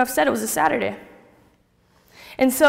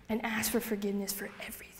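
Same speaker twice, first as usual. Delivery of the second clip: whisper; 2 semitones lower, 14 dB quieter, faster than usual. Retold or imitated. Tempting inner voice whispers.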